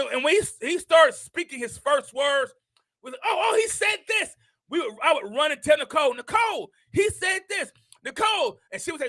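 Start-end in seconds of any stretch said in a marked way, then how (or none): 3.1-4.3 higher, quick excited report; thrilled, rushed and higher-pitched; excited grandparent. Retold or imitated. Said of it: "We both would get excited". 6.1-8.6 higher-pitched excited calling to wife; eager, high-pitched call; excited grandparent. Retold or imitated. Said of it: "We both would get excited".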